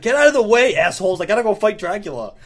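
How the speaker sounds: exasperated shout, high and loud at first; mock annoyed. Acting out annoyed Thor clearing civilians away, mock exasperated shout. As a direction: snap it out in playful irritation. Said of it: "he's annoyed".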